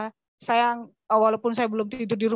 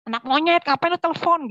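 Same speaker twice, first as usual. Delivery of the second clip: higher, louder scornful yell; mock angry yell; 6 semitones higher, 3 dB louder than usual. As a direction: snap at the caller like a furious father. Father curses her on phone. (bentakan marah ayah, ditirukan)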